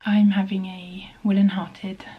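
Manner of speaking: normal talking voice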